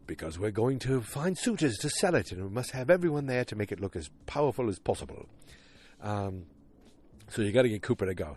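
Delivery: emphatic, swooping caricature Brit; mock British accent. Other British boss explaining the sale, mock British accent. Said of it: "then the other guy".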